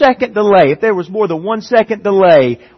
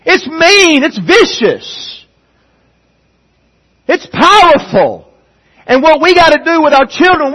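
raised, emphatic sermon voice; forceful preaching; 6 semitones higher, 4 dB louder than usual. Real, not acted. Preacher urging dads about children and the Bible, forceful preaching. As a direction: drive each point home to the congregation.

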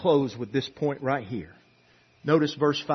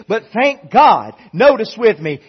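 loud, rising sermon voice; loud revival preaching; full-force preaching; 6 semitones higher, 10 dB louder than usual. Acted. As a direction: preach it hard to the church.

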